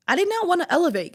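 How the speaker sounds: high, quick reluctant admission; emphatic confession. Real, not acted. Confessing she did not want to grow, high emphatic blurt. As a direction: blurt it out, pitched well up.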